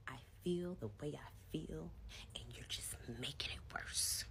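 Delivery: whispered husband's line; breathy whisper impression of the husband; mock whisper. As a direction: hush it out, barely voiced.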